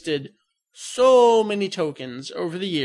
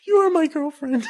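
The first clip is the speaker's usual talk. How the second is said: high, squeaky gush through laughter; gushing mock swoon; 10 semitones higher, 3 dB louder than usual. Boyfriend proudly gushing to girlfriend, high mock swoon.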